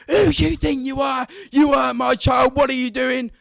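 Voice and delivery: high, shouted tirade of an enraged mother; vile screaming abuse. Retold or imitated. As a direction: scream it at someone who hurt your child. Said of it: "just pure vileness spills out".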